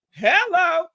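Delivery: ordinary talk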